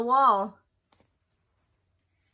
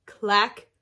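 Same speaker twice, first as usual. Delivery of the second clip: plain reading voice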